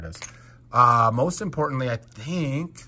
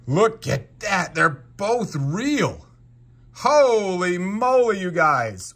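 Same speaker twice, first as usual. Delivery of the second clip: higher, louder and slow with delight; thrilled; 7 semitones higher, 5 dB louder, slower than usual. Real, not acted. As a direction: savor the win out loud. Both rare figures turn out real.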